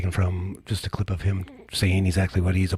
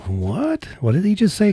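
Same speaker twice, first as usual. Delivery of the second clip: pitched way up, incredulous "What did he just say"; mock disbelief; 12 semitones higher than usual. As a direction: replay your own stunned reaction. Recalls disbelief watching Trump.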